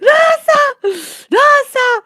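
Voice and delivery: very high, laughed-out squeal; helpless laughter. Real, not acted. Storyteller overcome by her own story, helplessly laughing.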